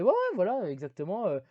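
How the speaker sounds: hint of a smug older brother; Chuck's answer. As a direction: play the brother too sure of himself. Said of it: "Et Chuck fait".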